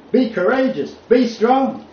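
Be courageous, Be strong rising high and loud; preacher's climactic call to be strong; rousing exhortation. Shown as a demonstration. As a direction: build to a rallying cry. Climax of the scripture reading.

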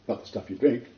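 ordinary talk, nothing marked

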